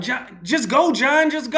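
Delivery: high, loud, frantic plea to leave; panicked urging. Retold or imitated. Friend begging the driver to flee the gun, frantic. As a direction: beg him to drive away now. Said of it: "And he was like".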